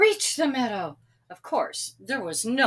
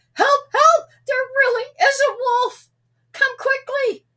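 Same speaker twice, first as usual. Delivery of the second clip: desperate yell; 10 dB louder than usual. A narrator's reading. Real wolf, Sam yells.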